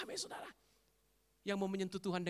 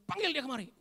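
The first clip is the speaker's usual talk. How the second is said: lightly voiced boss's summons, raised pitch; a hint of a gang leader giving orders; 9 semitones higher, 6 dB louder than usual. Jesus summoning the blind man, lightly like a gang boss. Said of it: "Yesus kayak ketua geng".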